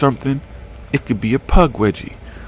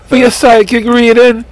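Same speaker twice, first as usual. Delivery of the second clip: Miss Cleo psychic; 10 semitones higher, 3 dB louder than usual. Retold or imitated. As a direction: sell a psychic reading like a TV hotline ad. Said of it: "he starts talking about Miss Cleo".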